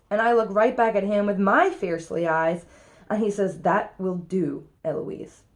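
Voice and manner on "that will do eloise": drawn-out stern reprimand, unshouted; angry tutor. Acted. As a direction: end the matter slowly, softening on the name. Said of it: "Here's what makes Philip angry".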